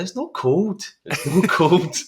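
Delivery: high-pitched laughing protest; mock protest. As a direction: squeak it in disbelief, breaking into laughter. Mocks locals calling it cold.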